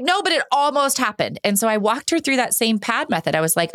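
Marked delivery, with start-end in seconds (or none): 0.0-1.4 put-on higher kid voice, pouting; little daughter insisting. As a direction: play the girl clinging to her grievance.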